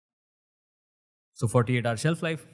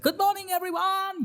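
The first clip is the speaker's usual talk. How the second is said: high Hong Kong English greeting; Hong Kong accent. Retold or imitated. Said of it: "in that Hong Kong accent".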